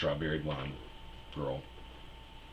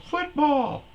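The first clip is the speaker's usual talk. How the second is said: very high, loud panicked squeak; high squeaky self; 9 dB louder than usual. Retold or imitated. Comic mocking his own answer, high squeaky blurt. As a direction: blurt it in a nervous falsetto.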